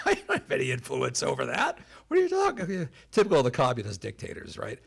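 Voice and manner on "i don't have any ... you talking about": mock-indignant protest pitched way up; incredulous protest. Speaker mimics KGB chief in Warsaw defending himself, incredulous.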